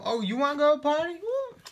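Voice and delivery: high, loud, quick teasing question rising into a woo; excited party invite. Pretend. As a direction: squeal it like a mock party girl. Playfully asks viewers to party.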